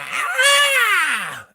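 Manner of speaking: high, loud sustained shriek; electronic scream. Acted. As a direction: scream out like a machine. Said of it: "She does an electronic scream thing".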